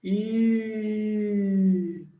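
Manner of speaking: long I held through a fake smile. Shown as a demonstration. Teacher shows the little fake smile, held I. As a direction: stretch I with lips spread in a smile. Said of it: "aparece mesmo um sorrisinho falso".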